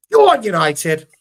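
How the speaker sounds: loud, high, drawn-out fan shout; rousing cheer. Real, not acted. Host signing off after semi-final win, rousing shout. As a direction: cheer your team on at full voice.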